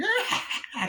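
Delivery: high giggle; a quick high laugh. Retold or imitated. Tiffany laughs along at Chucky's kill with a high giggle. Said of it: "kinda, like, laughing".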